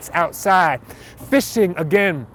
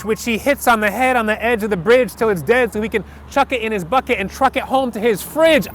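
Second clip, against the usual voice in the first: ordinary talk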